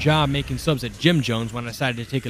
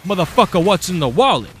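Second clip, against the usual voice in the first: higher, louder mock thug demand; mugger; 6 semitones higher, 5 dB louder than usual. Mugger grills the narrator about his wallet, mock tough. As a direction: press him like a pushy mugger. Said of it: "To which he's like".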